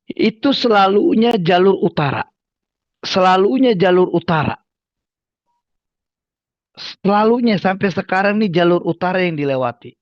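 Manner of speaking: "selalunya" hit hard and loud each time; emphatic repetition. Real, not acted. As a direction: drive home "selalunya" with every repeat. Repeats the point emphatically.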